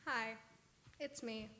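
ordinary talk

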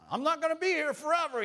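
high, loud mock parent voice; parent warning. Pastor imitating a parent telling kids, high and loud. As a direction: squeal it like a nagging parent.

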